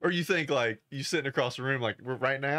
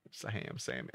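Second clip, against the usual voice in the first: whispered guess; 3 semitones lower, 10 dB quieter than usual. Pretend.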